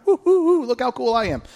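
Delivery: high excited whooping brag; mock self-hype, high; bragging whoop. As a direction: cheer for yourself like a show-off. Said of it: "Jared, if he was a rabbi, would be going".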